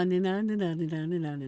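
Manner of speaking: ordinary delivery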